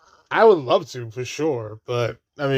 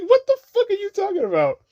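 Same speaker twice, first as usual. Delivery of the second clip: a sudden high-pitched "what are you even saying"; high mock outrage. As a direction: quote it in a squeaky, much higher, incredulous voice. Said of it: "I was like".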